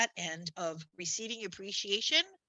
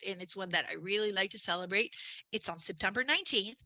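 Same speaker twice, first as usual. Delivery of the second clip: normal talking voice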